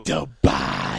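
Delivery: very low, loud announcer "The Body"; deep voice. Acted. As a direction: drop into a booming low growl. Echoing handle in deep voice.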